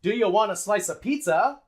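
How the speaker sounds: quick "duh-yuh wanna slice-uh", then a full "pizza"; linked, full content word. Shown as a demonstration. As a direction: link "Do you want a slice of" and say "pizza" fully. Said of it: "we say it fully".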